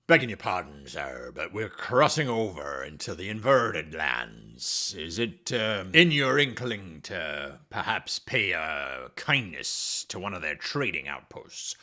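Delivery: put-on low, slow old sea captain; pirate voice. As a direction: growl it like a salty pirate captain. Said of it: "I just like doing the pirate voice".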